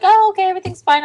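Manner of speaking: high, loud, quick reassurance; imitated nice cop. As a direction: imitate an officer breezily wrapping up. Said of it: "He's like".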